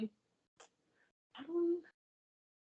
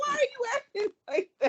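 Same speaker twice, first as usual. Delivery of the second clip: squealing with laughter, much higher; laughing through words; 10 semitones higher than usual. Real, not acted. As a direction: laugh the question out. Laughing while imitating a teacher.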